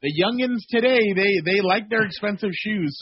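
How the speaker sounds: put-on high, teasing old-man tone; lightly voiced old-timer quip; mock wise elder. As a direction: tease like a grandpa about kids. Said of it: "I'm like".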